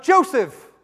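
loud, high startling call; sudden shout. Shown as a demonstration. Preacher suddenly calls out a congregant's name, shouting. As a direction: shout the name to wake someone up. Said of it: "if I suddenly shouted out".

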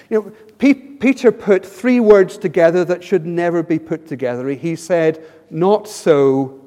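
usual speaking voice

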